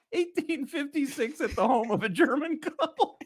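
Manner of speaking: losing it with laughter mid-question; cracking up. Real, not acted. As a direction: squeak the question out while trying not to laugh. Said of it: "can't even read the question with a straight face".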